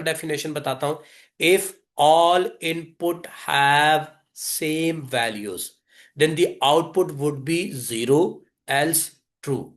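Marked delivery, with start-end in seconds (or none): 1.4-9.6 very slow, measured word-by-word delivery; slow dictation. Real, not acted. Teacher stating a simple definition to students, slow dictation. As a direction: give each word its own space.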